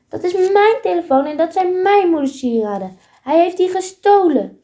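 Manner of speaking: high, loud and angry. Acted. Goes finds her stolen phone, angry. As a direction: snap it out in outrage. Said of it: "zei Goes boos".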